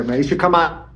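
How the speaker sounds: ordinary talk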